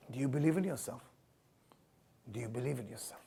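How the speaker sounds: hushed sincere question; soft question. Acted. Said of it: "repeated question".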